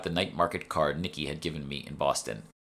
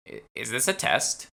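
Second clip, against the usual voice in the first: lightly voiced old woman, wary; a hint of a suspicious shopkeeper; puzzled old woman; 6 semitones higher than usual. Shopwoman suspecting a test, wary old voice.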